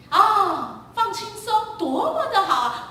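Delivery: high, loud gushing with a laugh; hammed-up delight. Parent gushing to the kids about the relaxing trip, mock delight. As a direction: overdo the holiday joy.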